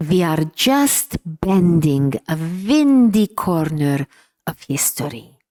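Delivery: slow, deliberate imitation of a Hungarian accent. Father encouraging his discouraged child, in a Hungarian accent. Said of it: "in his inimitable Hungarian accent".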